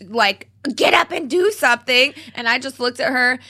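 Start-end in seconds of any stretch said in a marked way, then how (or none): 0.8-2.1 high, loud imitated command; urgent order. Retelling Maria ordering help during a panic attack, high urgent shout. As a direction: imitate a relative urgently ordering someone to act.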